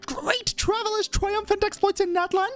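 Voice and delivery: high, eager reporter; pry for a story in a high, put-on voice; improvised character voice. Reporter angling for the Traveler's exploits, high and eager. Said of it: "I can't remember what she fucking sounds like".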